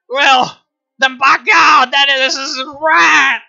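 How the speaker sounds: high, building to a loud shouted caricature rant; mock rant. Mocking the merchant's right to sell opium, shouting. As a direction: ham up a self-righteous opium trader.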